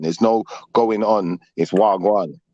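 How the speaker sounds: ordinary talk, nothing marked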